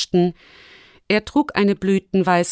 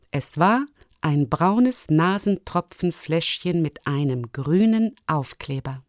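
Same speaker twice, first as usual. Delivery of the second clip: ordinary delivery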